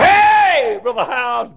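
sudden high loud hail; excited call. Retold or imitated. Russell calling to Brother Hyles about gold, loud and high. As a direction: grab his friend's attention eagerly.

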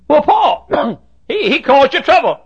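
high, shouted objection; loud protest. Acted. Objector reminding Paul of past trouble, loud protest. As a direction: protest loudly, pitch way up.

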